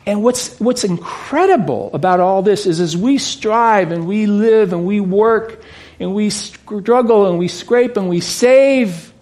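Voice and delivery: ordinary talk, nothing marked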